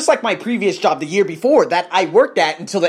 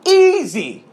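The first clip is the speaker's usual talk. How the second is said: high, loud cocky easy; boastful shout; 11 semitones higher, 5 dB louder than usual. Real, not acted. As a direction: toss it off like nothing. Boastful punchline.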